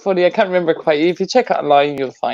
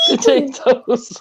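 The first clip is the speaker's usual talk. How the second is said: laughing mock accent; 9 semitones higher, much slower than usual. Joining the Irish accent mockery.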